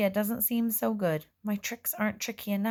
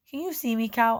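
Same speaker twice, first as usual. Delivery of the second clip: anxious question; 4 semitones higher, 4 dB louder than usual. A narrator's reading. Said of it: "I ask".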